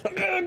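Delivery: high, sudden mock yell; hammed-up creature shout; acted creature yelp. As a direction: let out a high kobold cry.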